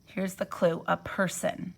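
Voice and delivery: ordinary delivery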